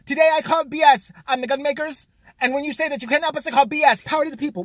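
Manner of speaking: loud, high mock protest speech; mocking Emma. Mocking the girl at the microphone, loud and high. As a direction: ham up a fiery rally speech. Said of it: "She's like".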